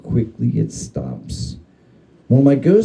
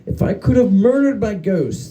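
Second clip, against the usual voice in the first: plain reading voice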